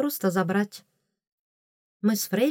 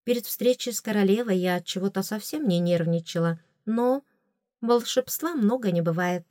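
ordinary delivery

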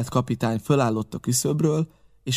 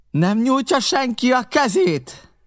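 very high, slow, louder bellow; furious pirate roaring for a hand up; 13 semitones higher, 4 dB louder, slower than usual. A narrator's reading. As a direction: roar it at the men who won't help. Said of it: "üvöltötte".

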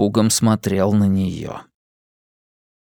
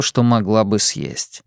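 plain reading voice

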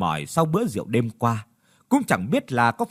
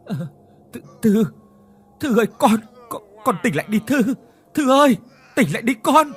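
desperate pleading; 9 semitones higher, 5 dB louder than usual. Acted. Father begs dead daughter.

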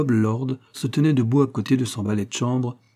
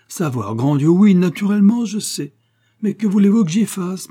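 much higher and louder, flustered protest; flustered exclamation; 9 semitones higher, 7 dB louder than usual. A narrator's reading. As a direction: throw up your hands in exasperation. Lord brushes off the widow. (le lord s'exclame, agacé, beaucoup plus haut et fort)

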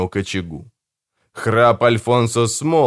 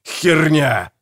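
furious retort; 5 semitones higher than usual. A narrator's reading. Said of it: "отозвался Гегель".

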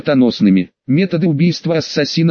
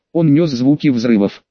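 ordinary delivery

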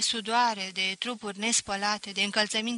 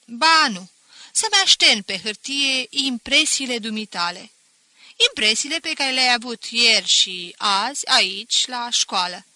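loud, sarcastic schoolmaster; mock him with overdone politeness; teacher taunting the pupil at the desk; 8 dB louder than usual. Teacher mocking the boy.

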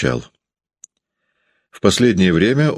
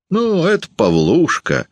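high smirking reply; a smirking wife naming the guest; 12 semitones higher than usual. Acted. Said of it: "ухмыляясь".

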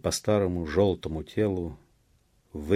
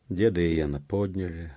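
ordinary delivery, nothing marked

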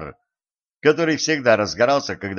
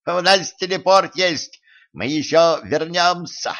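high-pitched tiny knights; squeaky taunting; 7 semitones higher than usual. A narrator's reading. Knights in a mouse hole promising return, squeaky. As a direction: squeak the threat from hiding. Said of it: "запищали рыцари из мышиной норки".

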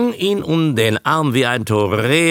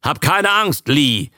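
raised, higher call of reassurance; 6 semitones higher than usual. A narrator's reading. Man telling his frightened darling not to fear, calling out. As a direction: call to her over the animals. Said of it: "rief Abe".

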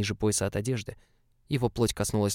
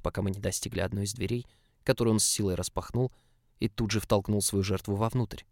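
plain reading voice

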